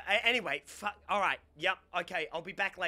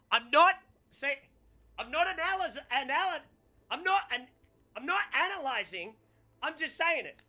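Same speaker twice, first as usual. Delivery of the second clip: high-pitched, stumbling denial; defensive, voice jumping up; flustered protest; 7 semitones higher than usual. Real, not acted. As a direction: protest defensively, tripping over the words.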